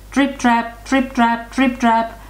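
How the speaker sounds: high, rhythmic chant; middle-sized hoofbeats. A narrator's reading. Narrator sounds middle-sized hoofs on the bridge, high chant. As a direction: sound out the trotting in even beats.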